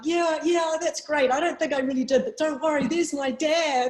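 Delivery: high, bright yeah yeah; too thrilled to care about winning; excited brush-off. Retold or imitated.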